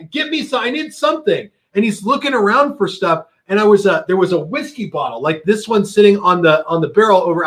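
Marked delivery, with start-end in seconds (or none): 0.1-1.5 high, urgent plea; frantic and urgent. Retold or imitated. Retold urgent demand.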